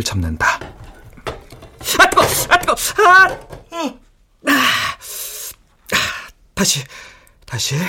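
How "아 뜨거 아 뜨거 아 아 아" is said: sharp cries from a burn; pained yelps. Real, not acted. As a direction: yelp at the heat. Grabs hot pot.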